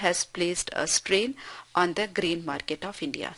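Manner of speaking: each word spaced out slowly for the class; slow dictation reading. Shown as a demonstration. Teacher reading an example sentence to students, slow and deliberate.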